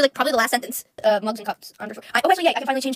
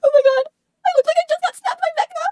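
very high, tearful-sounding squeal; squealing outburst; 7 dB louder than usual. Real, not acted. Streamer reacts to looking stabbed by Vecna, high squeal.

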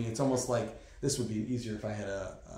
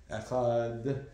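Echad very slow, its last syllable held long; elongated Echad. Shown as a demonstration. Teacher showing that it is not Acher, Echad slowly drawn out. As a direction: stretch Echad out to a clear final d. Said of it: "you wanna make sure you know it's Echad".

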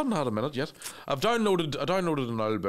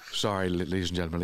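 usual speaking voice

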